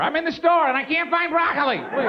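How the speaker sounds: put-on high-pitched, pleading old lady cry for help; distressed grandmother. Grandmother calls for help from the store, mock-distressed. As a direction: wail it like a helpless granny. Grandmother abusing emergency call gadget.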